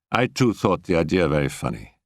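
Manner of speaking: ordinary delivery, nothing marked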